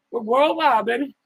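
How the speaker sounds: excited hype-man call; hype catchphrase. Real, not acted. Hyped repeat of the catchphrase.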